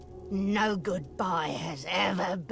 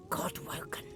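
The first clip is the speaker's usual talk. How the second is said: whispering; 7 dB quieter, faster than usual. Acted. Ghost whispers name.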